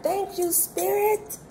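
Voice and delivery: a bright, high-pitched thank you; grateful high thanks. Real, not acted. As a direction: sing the thanks up high to Spirit. Thanking Spirit after Fool card.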